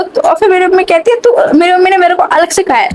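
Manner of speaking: ordinary talk, nothing marked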